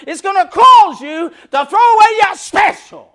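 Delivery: high, loud whooping preacher cadence; shouted gospel preaching; preaching at full force. Real, not acted. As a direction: drive the sermon home.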